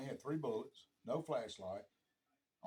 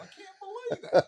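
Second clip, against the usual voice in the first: high, loud, gleeful disbelief; laughing mockery; 13 dB louder than usual. Buddy teases lost country boy, high laughing mockery. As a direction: rib him through your laughter. Mocking friend's retold ribbing, laughing.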